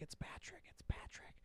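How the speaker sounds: imitated little kid's whisper; hushed, voiceless kid whisper. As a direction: whisper it like a secret to your brother. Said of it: "she was, like, whispering to him".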